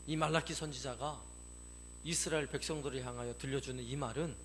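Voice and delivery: normal talking voice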